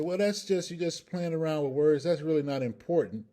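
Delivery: high, dismissive objection from the doubters; imagined objector. Acted. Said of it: "People say".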